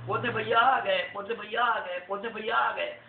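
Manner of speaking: high, loud, slow cheering; excited cheering. Acted. Neighbourhood crowd welcoming Potne back, excited. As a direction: cheer him home.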